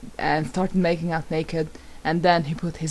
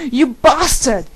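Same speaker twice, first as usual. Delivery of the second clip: higher and much louder, furious; angry shout; 7 semitones higher, 10 dB louder, slower than usual. A narrator's reading. Narrator shouts furiously at Draco in bed. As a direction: hurl it at him in rage. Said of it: "I shouted angrily".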